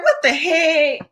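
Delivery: high-pitched "are you kidding me" reaction; exasperated. Acted. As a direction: play up indignant disbelief. Acts out exasperated creator reaction.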